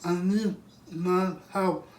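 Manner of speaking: high, breathless plea on the phone; panicked emergency call. Acted.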